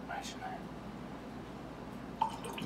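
hushed whisper. Pretend. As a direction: breathe it like a secret. Whispered aside.

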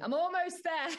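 cheery dad. Retold or imitated. Dad's cheeky screenshot message.

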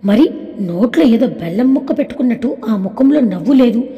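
ordinary delivery, nothing marked

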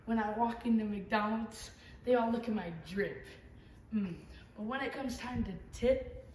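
usual speaking voice

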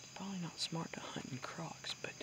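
hushed hunter whisper. Real, not acted. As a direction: whisper so prey won't hear. Sneaking through woods hunting.

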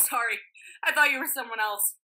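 ordinary talk, nothing marked

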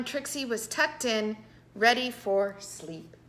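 ordinary delivery

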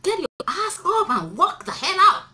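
high, loud command; forceful shout. Real, not acted. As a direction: order them to get up and work. Urging girls to work.

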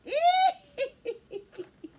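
high, loud gleeful cackle; witchy giggle. Pretend. Storyteller delights in her potion, high cackle. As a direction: squeal into a playful witch laugh.

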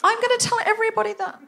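high, delighted and giggly; excited. Real, not acted. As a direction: burst out with glee. Delighted outburst after gasp.

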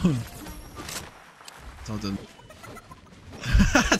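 breathy laugh through the words. Real, not acted. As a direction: say it while cracking up. Repeats the line while laughing.

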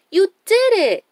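high, loud happy cheer. A narrator's reading. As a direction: cheer for a friend's success. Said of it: "Dora and Boots cheered".

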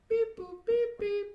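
high mock dial-tone beeps; phone dial beeps. Teen home alone mimicking the phone keypad, high beeps. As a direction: chirp the keypad tones up high.

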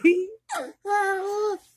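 high burst of laughter; laughing. Real, not acted. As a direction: crack up laughing. Grandma laughing at baby.

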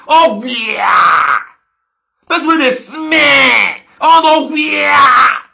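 loud mock outcry of disgust; disgusted shout. Real, not acted. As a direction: yell it in mock revulsion.

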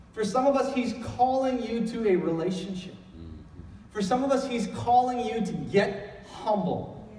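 ordinary talk, nothing marked

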